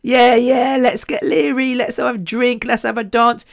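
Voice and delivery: lightly voiced tipsy reveller; mock party-goer. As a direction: lightly mimic a rowdy night out. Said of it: "just the usual kind of".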